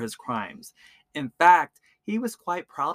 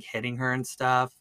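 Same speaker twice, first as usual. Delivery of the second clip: ordinary talk, nothing marked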